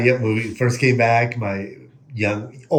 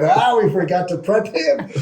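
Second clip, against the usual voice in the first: laughing realization; 8 semitones higher, 3 dB louder than usual. Retold or imitated.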